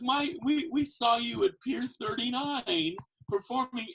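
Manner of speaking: a stranger sure she's found him; curious woman. Retold or imitated.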